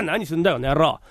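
ordinary talk

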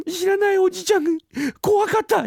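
put-on very high, frightened little child voice; feigned fright. Boy disowning his father in public, feigned fright. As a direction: play the terrified child for bystanders.

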